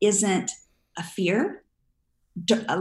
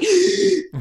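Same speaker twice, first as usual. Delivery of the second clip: sharp high mock gasp of fright; panicked gasp; 12 semitones higher, 6 dB louder than usual. Nervous flyer hit by turbulence, a loud mock gasp. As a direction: gasp like the plane just dropped. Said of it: "I'm usually the one like".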